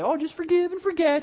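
high, mocking singsong; glib mimicry. Mimics a glib piece of advice.

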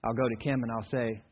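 ordinary talk, nothing marked

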